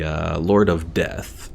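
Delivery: ordinary delivery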